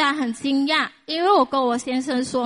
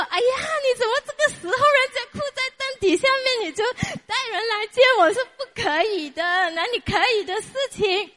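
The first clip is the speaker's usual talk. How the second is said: angry scolding; 8 semitones higher than usual. Retold or imitated. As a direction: snap at husband in a fluster. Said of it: "我就很生气".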